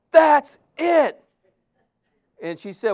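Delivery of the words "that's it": high, loud "That's it" of frustrated giving up; upset outburst. Retold or imitated. Preacher retells quitting in front of a Chinese sister, loud fed-up outburst. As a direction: throw up your hands, loud and fed up. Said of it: "And I was so upset".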